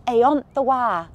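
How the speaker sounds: "Ayont the wa" in broad Ulster Scots; Ulster Scots phrase. Shown as a demonstration. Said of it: "Ulster Scots words and phrases I'd like you to listen out for".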